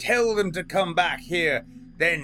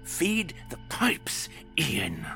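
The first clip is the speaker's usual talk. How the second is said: high, slow whispered sneer; 8 semitones higher, 6 dB quieter, slower than usual. A narrator's reading. The waiter sneering a slow whisper at Ian. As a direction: taunt him in a hush. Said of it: "the waiter sneered".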